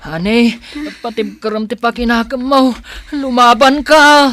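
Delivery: loud, high pleading; desperate urging. Acted. Son begging his mother to fight, loud and desperate. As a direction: beg her to hold on.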